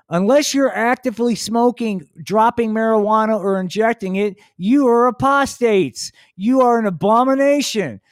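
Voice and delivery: fiery stoner-Jesus sermon; ranting Jesus. Acted. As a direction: condemn everyone at full tilt. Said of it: "Jesus preaching continues".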